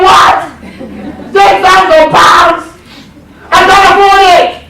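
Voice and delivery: high, loud, slow disbelieving cry with a laugh; almost shrieking ten thousand pounds in shock; shocked outburst. Retold or imitated. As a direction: gasp it out as if the price floored you. Shocked at the price.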